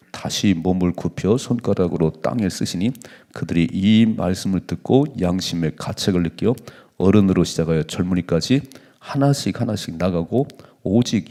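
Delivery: loud group Bible reading; scripture reading. Acted. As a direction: read the verse out together. Congregation reads scripture aloud. (성경 봉독)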